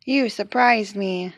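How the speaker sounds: ordinary delivery, nothing marked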